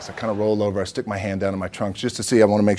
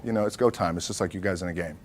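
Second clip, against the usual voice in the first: ordinary talk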